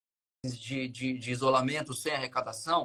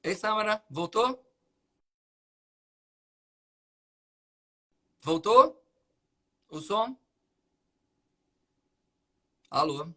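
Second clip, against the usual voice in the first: raised, higher calls into a dead line; to someone hard of hearing; 3 semitones higher than usual. Real, not acted. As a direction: call out to check she's still there. Call drops, guest can't hear.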